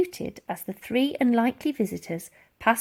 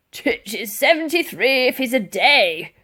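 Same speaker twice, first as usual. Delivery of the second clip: gruff sergeant major; 4 semitones higher, 5 dB louder than usual. A narrator's reading.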